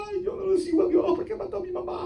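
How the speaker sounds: high-pitched, soft fake crying; mock whining. Preacher mocking someone blaming God, exaggerated whining. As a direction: whimper it in a high, hammed-up wail.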